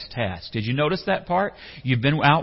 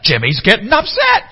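much higher, loud petulant voice; third-person tantrum; 10 semitones higher, 13 dB louder than usual. Retold or imitated. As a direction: announce it like a pouting Seinfeld guy. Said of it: "He's like".

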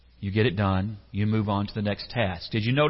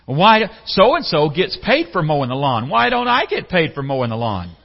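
put-on higher, louder whining kid; whiny kid; 5 semitones higher, 9 dB louder than usual. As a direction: beg like a boy after more allowance. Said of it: "That's a quote of a young boy".

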